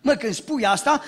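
usual speaking voice

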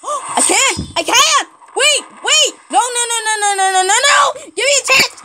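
high, frantic cries; panicked pleading. Real, not acted. Gamer begging an attacker for a chance, panicked. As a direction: plead frantically, pitch shooting up.

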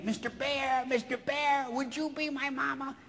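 soft, high little duckling voice; little duck. Acted. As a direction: plead like a tiny cartoon duck looking for its mother. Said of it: "there was a little duck".

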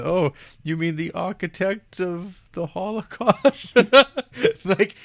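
high, loud hammed-up disbelief; squeaky-high comic incredulous retelling through laughter; mock incredulity, laughing. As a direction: crack up while acting out outraged questions. Mocking incredulous reply, cracking up.

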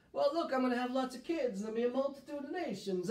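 fast, high mock Italian accent as Abraham; a playful Italian accent, lifted high. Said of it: "I turned Italian right there".

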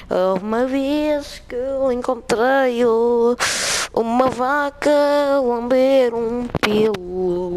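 hammed-up loud story; loud mock storytelling. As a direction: declaim a silly tale loudly. Loud slow silly telling.

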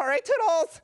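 high, loud singsong goodbye; perky farewell. Acted. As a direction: sing out a bubbly farewell. Chatty stranger's exaggerated goodbye.

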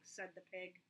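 usual speaking voice

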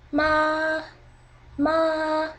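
"Ma" held high and dead level; high flat tone. Shown as a demonstration. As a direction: sustain "Ma" on one high note. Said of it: "Flat tone".

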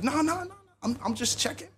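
lightly acted retreat from teasing; hasty backpedal. Friend backpedals after teasing about crying.

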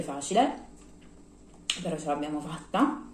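speaking with food in mouth; words muffled by chewing; chewing while talking. Real, not acted. Seen 3: Cheeks bulge, mouth working between words, bread held in hand.

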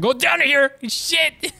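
high, urgent outburst; high-pitched gaming panic; panicked yelp. Real, not acted. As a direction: yelp it as the enemy closes in. Startled by a big enemy.

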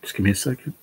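fighting back tears to get the words out; real emotion breaking through mid-sentence; choked up. Real, not acted. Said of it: "I'm getting emotional already".

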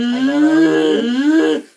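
very high, very loud, dragged-out shriek. Retold or imitated. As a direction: scream it in a rage.

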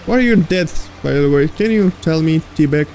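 normal talking voice

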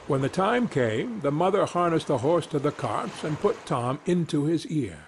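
plain reading voice

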